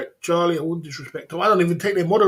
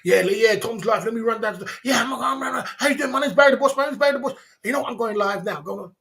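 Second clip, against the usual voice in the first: higher and louder, a hammed-up braggart streamer; mocking a show-off streamer; 6 semitones higher, 3 dB louder than usual. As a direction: parody the pushy guy barging into chats. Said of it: "when people do do things like going".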